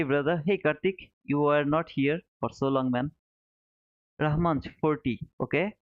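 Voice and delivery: normal talking voice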